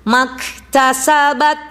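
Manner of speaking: slow drawn "Maktasabat" with long vowels; recitation model. Shown as a demonstration. Teacher models the word for the class, slow and drawn out. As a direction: stretch "Maktasabat" syllable by syllable.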